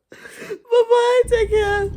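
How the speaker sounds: very high, loud squeaky farewell; hammed-up weepy sign-off; mock-tearful goodbye. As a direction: wail goodbye in pretend tears.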